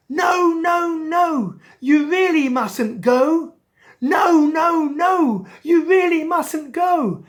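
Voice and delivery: high, loud pleading cry; shouted warning. Acted. School of fish warning Angelfish, a high loud pleading cry. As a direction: shout the warning with desperate urgency. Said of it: "they all wanted to shout out".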